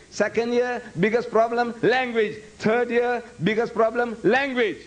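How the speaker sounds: 1 emphatic preaching. Real, not acted. Preacher hammering home a point.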